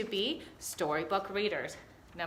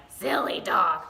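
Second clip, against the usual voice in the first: lightly voiced troll, a touch louder; 6 dB louder, slower than usual. Shown as a demonstration. Teacher showing the wrong voice for Vicky, a mild troll. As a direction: growl it a little like a troll. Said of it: "would she sound like a troll?".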